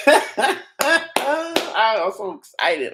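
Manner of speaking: very slow, laughing "I'm so excited" stretched out. Real, not acted. Streamer giggling at his viral clip, drawn out and laughing. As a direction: laugh through every word.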